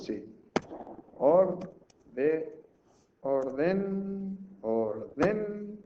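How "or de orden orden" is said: held, higher syllables with pauses between; drawn-out word division. Shown as a demonstration. Teacher working out another way to divide the word, drawn out. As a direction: try out the split aloud, stretching each piece. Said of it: "¿Hay más formas?".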